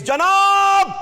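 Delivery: sudden high, loud cry to break the frenzy; alarmed shout. Acted. Courtier crying out to Alkazi to stop, alarmed high shout. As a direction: shout to stop him mid-thrust.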